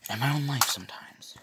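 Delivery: whispered add-on, half to oneself; whispered aside. Real, not acted. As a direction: tack it on in a whisper. Joking confessional aside.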